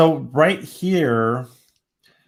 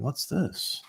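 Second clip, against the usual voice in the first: whisper; 2 semitones higher, 12 dB quieter than usual. Real, not acted. Leans in, curious at something.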